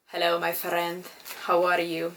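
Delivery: attempted German accent that slides into Russian; немецкий акцент. Shown as a demonstration. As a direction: greet in a put-on German accent, sounding rather Russian. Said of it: "practicing my German accent".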